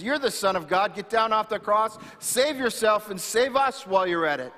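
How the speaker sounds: a thief jeering at a dying man from his own cross, mocking; mocking taunt. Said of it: "He mocks Jesus".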